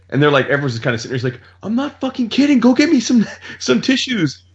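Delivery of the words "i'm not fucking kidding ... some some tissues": high, strained mock-panic yell; retold yelling. Dad selling an accident to the kids, mock yelling. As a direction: imitate him calling out in a panic. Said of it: "he's yelling at us".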